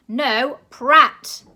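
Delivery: very loud correcting shout; loud correction. A narrator's reading. Grandma shouting the name at the director, very loud. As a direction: bellow the name at him.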